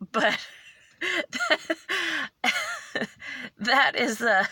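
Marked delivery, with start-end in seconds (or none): 1.0-3.5 high burst of laughter; long, high giggling fit; laughing. Real, not acted. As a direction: break up laughing at your own story. Breaks up after retelling cop's warning.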